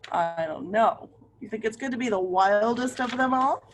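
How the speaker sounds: ordinary delivery, nothing marked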